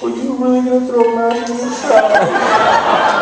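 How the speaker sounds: put-on high, teary little boy asking; whiny kid voice. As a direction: plead like a hurt child. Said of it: "He's like".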